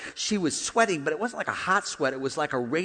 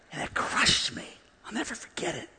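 near-whisper, softer; hushed, hurt; 6 semitones higher, 6 dB quieter than usual. Real, not acted. Preacher confiding how grandma's shame crushed him, hushed. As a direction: let the voice drop away on a painful memory.

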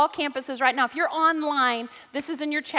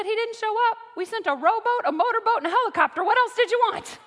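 higher, laughing through the list; laughing Saint Peter; 8 semitones higher than usual. Retold or imitated. Saint Peter chuckling at the man in heaven. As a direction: let the laugh break in as Peter scolds.

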